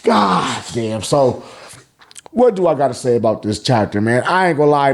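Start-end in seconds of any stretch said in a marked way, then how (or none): none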